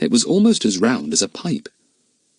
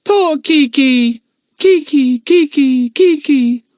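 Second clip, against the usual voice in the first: high, loud, slow repeated echo of the parrot; echoes; 7 dB louder, slower than usual. A narrator's reading. The cave echoing the frightened parrot's words. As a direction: let it ring back from the cave. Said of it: "called the echoes".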